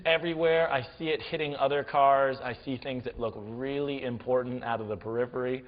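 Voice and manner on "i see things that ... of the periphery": plain reading voice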